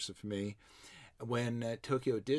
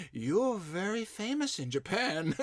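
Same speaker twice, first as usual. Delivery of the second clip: higher, slow imitation of a Japanese accent, laughing; light mock Japanese accent through a laugh; 8 semitones higher, slower than usual. Telling a friend what the Japanese lady said, in a light Japanese accent. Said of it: "I'm not making fun of her accent".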